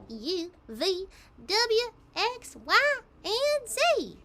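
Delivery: alphabet in a high, swung country twang, stretching Z slowly; Applin country alphabet. Shown as a demonstration. Voice actor recording the alphabet in her Applin accent. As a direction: recite it like the country pony would. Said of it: "I could go through the whole alphabet for you".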